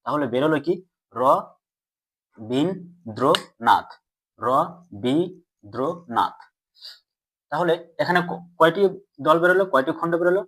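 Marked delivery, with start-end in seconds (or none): 2.4-3.9 রবীন্দ্রনাথ very slowly, split as র, বীন, দ্র, নাথ; syllable by syllable. Shown as a demonstration. Teacher shows students the syllables, sounding out রবীন্দ্রনাথ very slowly. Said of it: "স্বল্পতম প্রয়াসে উচ্চারণ করার চেষ্টা করলাম". 4.4-6.4 রবীন্দ্রনাথ very slowly, split as র, বীন, দ্র, নাথ; syllable by syllable. Shown as a demonstration. Teacher shows students the syllables, sounding out রবীন্দ্রনাথ very slowly. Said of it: "স্বল্পতম প্রয়াসে উচ্চারণ করার চেষ্টা করলাম".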